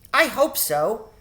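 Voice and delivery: put-on a small hopeful girl; young girl's voice. A narrator's reading. Cynthia's voice.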